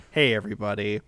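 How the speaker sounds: usual speaking voice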